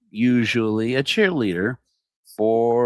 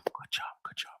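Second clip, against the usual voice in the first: whispered aside; 15 dB quieter than usual. Acted. Sly aside while praising reelection.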